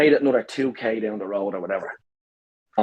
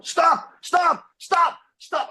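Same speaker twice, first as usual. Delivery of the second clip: high yelled commands; shouting stop; much slower than usual. Retold or imitated. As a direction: shout it at the phone. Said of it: "So I start going".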